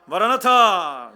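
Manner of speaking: slow shouted reply; slow loud answering cry. Shown as a demonstration. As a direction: stretch it out slowly and loudly. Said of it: "젊은 분 대답하십시다".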